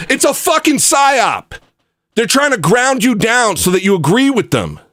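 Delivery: high, emphatic warning; heated rant. Real, not acted. Streamer telling viewers it's a psyop, heated.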